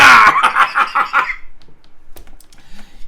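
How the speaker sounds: loud high whooping laugh; shouted laugh. Acted. Scrooge at the end of his rant, shouting laugh. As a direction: laugh it out at full voice.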